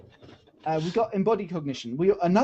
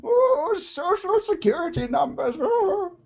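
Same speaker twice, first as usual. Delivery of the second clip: put-on old miner voice; slower than usual. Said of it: "that's my old, old miner voice".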